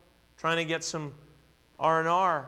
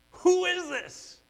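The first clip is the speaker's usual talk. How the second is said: much higher, slow, almost crying out; frightened cry; 13 semitones higher, 4 dB louder, slower than usual. Retold or imitated. Disciples after the storm stops, asking who Jesus is, in a high frightened cry. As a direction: voice the terrified disciples in mock alarm. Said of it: "And what do they say?".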